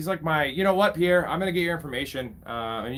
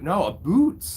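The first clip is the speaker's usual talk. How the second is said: put-on teasing "a boot" in a jokey Canadian accent, a bit higher; mock Canadian accent; 7 semitones higher, 5 dB louder, slower than usual. Streamer teasing a Canadian chatter, mock Canadian accent. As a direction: poke fun at the Canadian "about" as "a boot".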